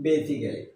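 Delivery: "basically" said as "be-si-ke-li", four even syllables; common mispronunciation. Shown as a demonstration. Teacher showing learners how we wrongly say it, mispronounced "basically" as be-si-ke-li. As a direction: say "basically" with a full "ke" before "li". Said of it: "কি বলি?".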